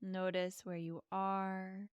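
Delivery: calm and unhurried, leave long pauses. Acted. Leads a guided meditation.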